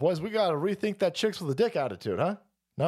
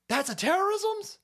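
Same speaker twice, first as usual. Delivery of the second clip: high rising question on terrorism's; terrorism's pushed way up in disbelief; mock incredulous question; 13 semitones higher than usual. Shown as a demonstration. Host mocking the phrase in high disbelief.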